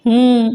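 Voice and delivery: short closed-mouth hum of yes; mm-hmm, just humoring her; humming assent. A narrator's reading. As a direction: hum a lazy yes through closed lips. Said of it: "ఊ కొడుతున్నాడు".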